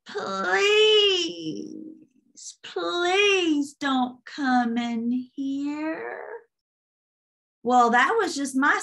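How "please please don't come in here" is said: high, drawn-out creaking plea; squeaky iron-door demon. Retold or imitated. Demon in a dream begging her to stay out, creaky high drawl. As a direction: stretch each word like a creaking iron door. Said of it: "his voice sounded like an iron door squeaking".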